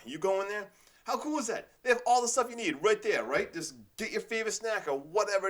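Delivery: high, loud enthusiasm; excited. Real, not acted. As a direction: rave with energy. Enthusiastic rant on convenience store.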